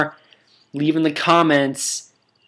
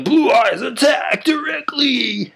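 high, slowed, put-on commanding yell; hammy high attack call; Kaiba command shout; 12 semitones higher, slower than usual. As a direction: order your dragon to attack like a villain. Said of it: "he'll be like".

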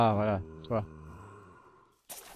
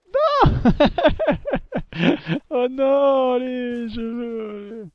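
real dismayed scream; 12 semitones higher, 11 dB louder, much slower than usual. Real, not acted. Creeper ruins his Minecraft run.

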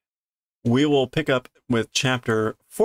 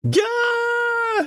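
sudden high shrieked gah; high frightened yelp; startled scream. Acted. Voicing scared girl seeing frog.

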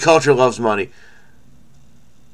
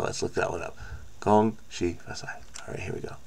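whispered aside; 6 semitones lower, 15 dB quieter than usual. Real, not acted. Muttered aside while searching.

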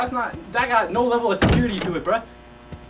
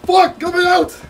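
louder, rising frantic repeats; tense panicked calling; 4 semitones higher, 8 dB louder than usual. Real, not acted. Player hunting for the hidden monster, tense loud calls. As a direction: call out while the threat hides.

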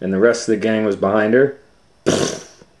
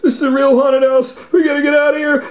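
high, loud scared Shaggy voice; high frightened whine; 6 dB louder, much faster than usual. Acted. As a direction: wail it like a terrified teen. Shaggy scared, haunted house.

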